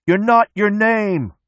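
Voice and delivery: high, slow, loud shout; yell into the wind. A narrator's reading. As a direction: stretch the yell out over the wind. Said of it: "Mechanic yells into the wind".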